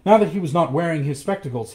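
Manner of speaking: ordinary delivery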